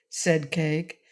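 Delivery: plain reading voice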